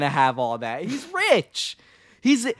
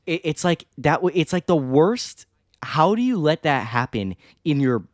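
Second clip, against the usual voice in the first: ordinary delivery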